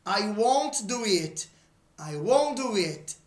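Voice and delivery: slow, careful model sentence; slow teacher demo; model sentence for repeating. Shown as a demonstration. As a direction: say it slowly for students to repeat. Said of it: "Repeat, please".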